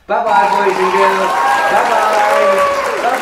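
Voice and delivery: loud, high sing-out farewell; calling goodbye. Real, not acted. Old Tom signs off to boys and girls, calling out. Waves off young audience.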